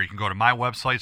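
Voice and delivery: ordinary talk, nothing marked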